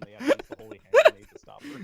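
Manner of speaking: loud, fast excited yell; excited shout. Retold or imitated. Monty Python sketch recap.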